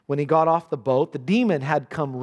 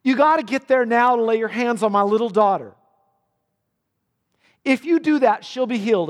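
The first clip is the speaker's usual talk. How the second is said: urgent plea; 5 semitones higher, 4 dB louder than usual. Acted. Jairus begging Jesus to come.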